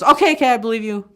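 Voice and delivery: high, loud okay okay; calm down a shouting uncle, loudly; loud placating. Retold or imitated. Kid placates yelling uncle.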